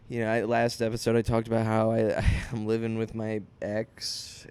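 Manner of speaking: ordinary talk, nothing marked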